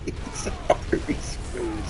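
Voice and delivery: slow hushed whisper; a slow whispered aside. Real, not acted. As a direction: breathe it out slowly. Aside about Arby's.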